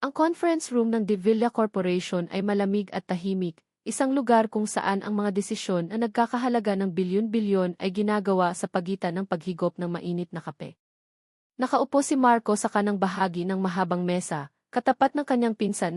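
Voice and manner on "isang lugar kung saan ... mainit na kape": plain reading voice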